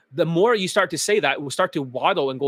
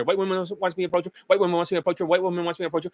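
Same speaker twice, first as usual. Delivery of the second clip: mantra rattled off fast; faster mantra; faster than usual. Shown as a demonstration. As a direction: speed the chant up, words tumbling. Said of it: "You gotta get used to saying it faster".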